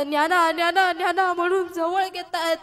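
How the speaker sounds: tender calling. Acted. Mother calling sleeping son.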